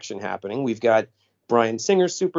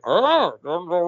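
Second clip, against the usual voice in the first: mock Bane voice; 2 semitones higher, slower than usual. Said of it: "It goes from like".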